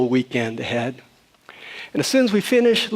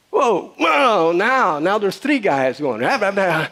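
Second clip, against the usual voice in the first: mock rising uproar; 5 semitones higher, slower than usual. Retelling a Starbucks quarrel to the congregation, mock uproar. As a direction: paint the room getting out of hand.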